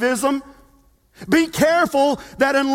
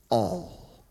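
hushed reveal; 8 dB quieter than usual. Real, not acted. Revealing key word softly.

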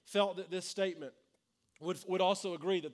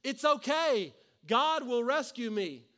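higher, louder shout, slow on the second line; shouting back; 6 semitones higher, 7 dB louder, slower than usual. Retold or imitated. Man on the roof shouting up to the helicopter. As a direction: yell it up at the helicopter. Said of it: "And he shouts back".

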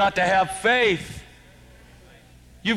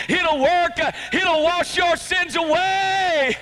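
full-force preaching; 10 semitones higher than usual. Acted.

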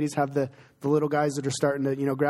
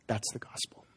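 hushed whisper, much softer; 5 semitones lower, 11 dB quieter than usual. Real, not acted. Pastor whispering the heart of the gospel to the congregation. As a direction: breathe it like a secret. Tender point in the sermon.

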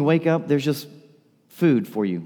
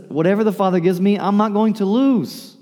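emphatic proclamation; 8 semitones higher, 5 dB louder than usual. Acted. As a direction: voice raised high and loud, pushing the claim. Preacher voices Jesus' bold claim.

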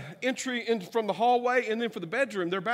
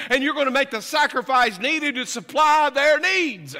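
loud, driving preacher cadence; full-force sermon delivery; preaching; 4 semitones higher, 7 dB louder than usual. Real, not acted. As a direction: preach it out to the congregation.

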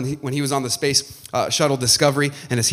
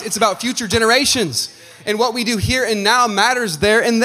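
louder, higher preaching voice; impassioned preaching; 7 semitones higher, 4 dB louder than usual. Real, not acted. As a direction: drive it home to the congregation with force. Preaches with full force.

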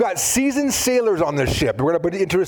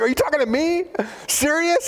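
Gideon's disbelief; 11 semitones higher than usual. Acted.

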